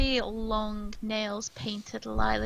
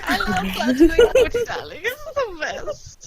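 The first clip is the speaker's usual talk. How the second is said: much higher and louder, breaking into laughter; camp tiefling laughing; 7 semitones higher, 9 dB louder than usual. Acted. Machi welcomes the party to his tavern, laughing through a high camp voice. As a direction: greet them grandly while cracking up. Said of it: "that's the wrong accent".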